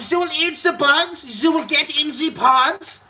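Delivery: high, slow put-on villain voice; mock him high and slow; hammed-up sinister globalist drone; mocking Klaus Schwab. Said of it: "the guy talks like this".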